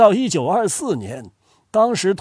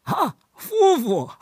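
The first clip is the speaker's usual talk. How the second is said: higher, slow amused echo of 夫妇; amused surprise; 6 semitones higher, slower than usual. Shown as a demonstration. Grandfather echoes the odd word, amused and surprised.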